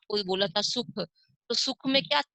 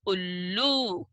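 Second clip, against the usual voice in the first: उल्लू slow, long ऊ at the end; teacher models the word; slow blended reading; much slower than usual. Shown as a demonstration. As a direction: read उल्लू slowly for the class. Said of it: "तो इसे पढ़ेंगे उ".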